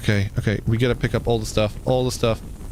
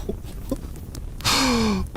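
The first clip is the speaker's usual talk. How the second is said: high, breathy disbelieving laugh; high despairing laugh; 11 semitones higher, 6 dB quieter than usual. Real, not acted. Streamer laughing helplessly as his tree burns, high disbelieving laugh.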